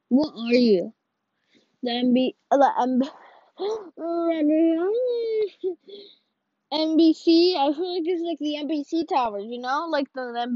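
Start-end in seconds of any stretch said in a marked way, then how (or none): 3.6-6.0 a sudden gasp of surprise; real surprised intake of breath; sharp startled gasp. Real, not acted. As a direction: gasp as if caught off guard. Startled by the landmark.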